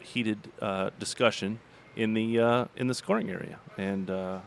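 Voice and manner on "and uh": ordinary talk, nothing marked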